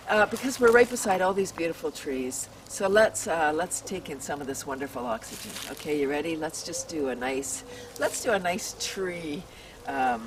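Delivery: gentle breathing-exercise guide; guided breathing. Acted.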